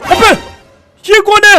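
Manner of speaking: high-pitched mock shock; startled gasping alarm. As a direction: play up a sudden monster sighting. Giant sea creature suddenly appears.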